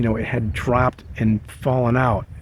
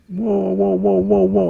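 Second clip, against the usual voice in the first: yelling; 8 semitones higher, 4 dB louder than usual. Retold or imitated. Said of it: "the whole time he's yelling".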